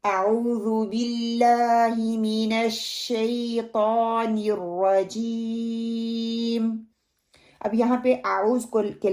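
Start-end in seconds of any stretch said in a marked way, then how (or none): 0.0-6.8 very slow chanted recitation; measured Quranic chant with long held vowels. Shown as a demonstration. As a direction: draw out every syllable of the recitation. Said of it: "pronunciation ویسے ہو".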